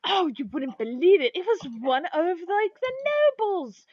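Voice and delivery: high, scandalized gossip voice; gossiping shopkeeper. Acted. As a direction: climb in pitch towards the juicy reveal. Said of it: "they're like".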